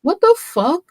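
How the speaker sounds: high, loud disbelief; outraged outburst. Real, not acted. Streamer reacting to a clip on manipulation, outraged outburst. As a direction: burst out at what you just heard.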